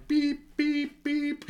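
high repeated reversing beeps; truck reversing alarm. Pretend. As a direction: beep like a truck backing up. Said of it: "I'm backing out".

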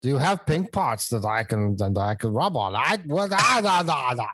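Gru voice. Shown as a demonstration. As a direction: growl it like a silly supervillain. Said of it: "talk dirty as Gru".

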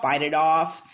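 ordinary talk

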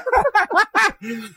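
high-pitched burst of laughter; squeaky giggle. Real, not acted. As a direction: let a real laugh break out, up high. Laughing at grammar joke.

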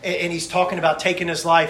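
ordinary talk

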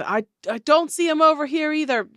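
loud high calling voice; man shouting. Acted. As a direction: yell orders to push in. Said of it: "you hear something".

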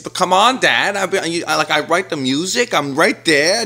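high, loud protesting son; Joe Vanelli. Acted. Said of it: "And he says".

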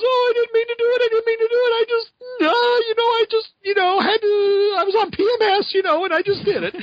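fake-crying, squeaky apology; whiny crying Liz. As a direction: ham up a weepy girl's excuse. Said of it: "and she goes".

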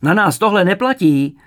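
loud, high bellow; furious roar. A narrator's reading. Evil interrogator to the fainting mother, roaring. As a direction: roar it at her. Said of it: "zařval zlý vyšetřovatel".

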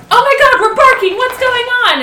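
high, loud frantic dog voice; hammed-up startled yapper's voice; excitable dog. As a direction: imitate a dog in a happy panic. Speaker voices excitable barking dog.

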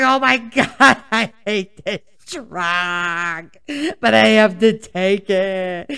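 loud hammed-up wail, words dragged out slowly; mock sobbing, wail the words long and loud; crying meltdown. Reenacting her prednisone meltdown over coffee, exaggerated crying. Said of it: "I know I'm crying over it".

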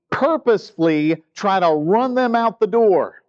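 high, emphatic mock complaint; grumbling disciples. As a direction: lecture Jesus like an insistent, frustrated ministry manager. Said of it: "give you a modern translation".

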